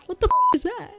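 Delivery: squeaked way up high, then dropping away; shocked stranger, pitch jumps way up; imagined shock. Retold or imitated. Gamer imagining a stranger landing there, high shocked squeak. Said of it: "just like".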